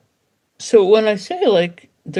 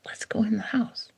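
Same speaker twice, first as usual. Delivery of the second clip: soft unvoiced whisper to the dog; whispered call; 3 semitones higher, 4 dB quieter than usual. Shown as a demonstration. Owner whispering to dog in the garden, hushed. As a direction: whisper it close so only the dog hears. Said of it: "tap him and be like".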